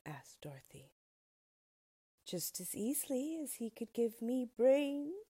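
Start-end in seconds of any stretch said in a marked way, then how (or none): 2.2-5.3 lightly voiced Scarecrow, higher and louder; a hint of a cheerful straw man. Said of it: "said the Scarecrow".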